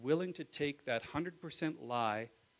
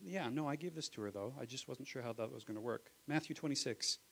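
usual speaking voice

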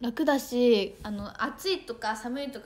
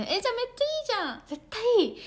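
大喜び; 11 semitones higher, 7 dB louder than usual. Real, not acted. Delighted at the news.

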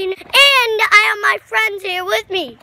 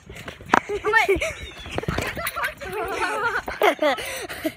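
laughing; 6 dB quieter than usual. Real, not acted. Laughing after fall.

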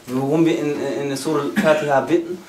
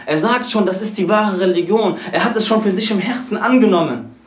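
higher, fervent sermon voice; impassioned preaching; 6 semitones higher than usual. Acted. Preacher to congregation about converts, preaching fervently. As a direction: lift the voice and preach it with fire.

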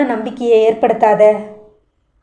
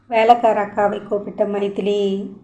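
ordinary delivery, nothing marked

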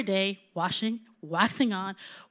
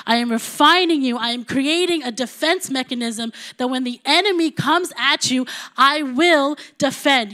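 1 preaching at full force; 6 dB louder than usual. Acted.

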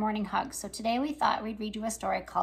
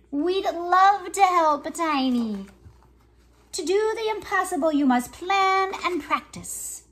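lively picture-book reading with voices for each character; reading to children; 5 dB louder than usual. Acted. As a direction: read it like story time for little kids. Reads picture book aloud.

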